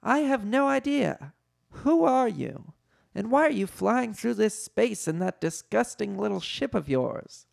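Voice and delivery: high, haughty questioning; high character voice, indignant; Leia. A narrator's reading.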